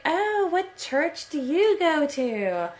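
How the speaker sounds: high, drawn-out gushing imitation; mock excited. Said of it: "like, all excited".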